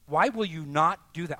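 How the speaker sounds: pressing, loud question. Acted. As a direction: demand it of the room. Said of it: "Why won't you do that?".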